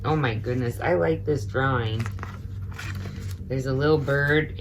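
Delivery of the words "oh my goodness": ordinary delivery, nothing marked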